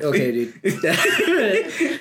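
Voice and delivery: very slow, drawn out through laughter; cracking up at the joke; laughing. Real, not acted. Laughs at being teased.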